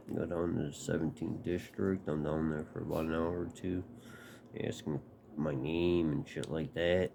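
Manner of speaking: ordinary delivery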